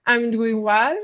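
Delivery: ordinary delivery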